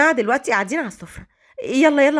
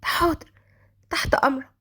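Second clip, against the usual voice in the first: hushed, timid obedience; nervous; 3 semitones higher, 3 dB quieter than usual. A narrator's reading. Maid answers the master who ordered tea, nervous. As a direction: answer softly, almost whispering with nerves. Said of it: "قالت ملاك بتوتر".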